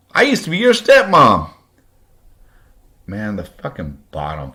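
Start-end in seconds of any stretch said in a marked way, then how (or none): 0.1-1.5 very high, loud mock hillbilly; hillbilly Kentucky accent. Stepmother revealing herself to him, loud mock Kentucky hillbilly accent. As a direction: mimic her Kentucky twang at full volume. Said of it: "with her ignorant hillbilly Kentucky fucking accent".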